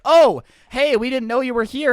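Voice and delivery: loud, high, badly faked surprise; mock surprise. Kids caught beside the gas cans, faked surprise. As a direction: play caught out, feigning innocence.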